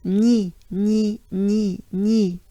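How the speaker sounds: "Ni" as "nyee", French gn into ee; banana-smile "ni". Shown as a demonstration. Teacher says it ensemble with learners, banana-smile "ni". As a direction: repeat "ni" in separate beats, lips spread. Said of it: "Let's take a good breath together".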